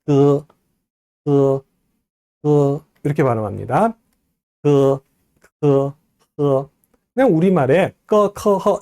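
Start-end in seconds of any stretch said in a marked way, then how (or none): 0.0-2.8 뜨, 뜨, 뜨 as long level throaty g, k, h syllables; tongue-root sounds. Shown as a demonstration. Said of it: "혀 뿌리 소리예요". 4.6-6.7 뜨, 뜨, 뜨 as long level throaty g, k, h syllables; tongue-root sounds. Shown as a demonstration. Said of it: "혀 뿌리 소리예요". 8.1-8.8 꺼, 커, 허 short with plain Korean open vowel; wrong Korean-style sounds. Shown as a demonstration. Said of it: "이렇게 하지 마세요".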